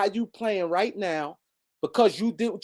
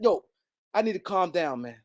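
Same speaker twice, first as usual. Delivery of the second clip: usual speaking voice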